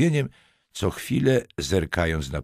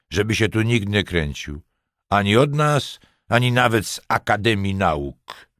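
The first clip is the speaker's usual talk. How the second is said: mocking; 5 semitones higher, 5 dB louder than usual. A narrator's reading. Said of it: "dodał drwiąco".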